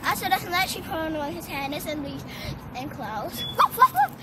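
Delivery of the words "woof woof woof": loud high puppy barks; dog barking. Pretend. Child singing about her dog, loud high puppy barks. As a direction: bark out loud like a little dog.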